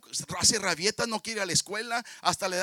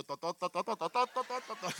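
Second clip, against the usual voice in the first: soft chuckled repetition, laughing through it; 6 dB quieter than usual. Real, not acted. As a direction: giggle the word over and over. Predicador repite la palabra riendo. (repitiendo riéndose, suave)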